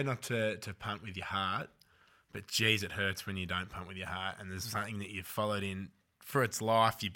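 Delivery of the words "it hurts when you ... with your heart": ordinary delivery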